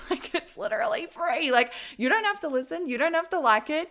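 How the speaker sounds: incredulous, it's free, come on; exasperated. Real, not acted. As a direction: throw your hands up at how obvious it is. Exasperated at hater comments.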